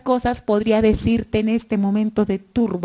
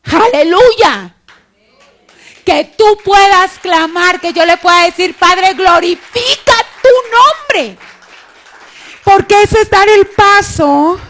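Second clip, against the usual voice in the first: fervent preaching shout; 11 semitones higher, 13 dB louder than usual. Real, not acted. Preacher rousing the congregation at the climax, shouting. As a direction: lift the hall with a climactic hallelujah.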